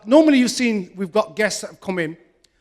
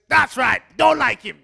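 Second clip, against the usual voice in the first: mock crowd shout; 2 semitones higher, 4 dB louder than usual. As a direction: call it out like a heckling audience. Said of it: "Everybody else says".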